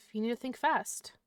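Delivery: ordinary delivery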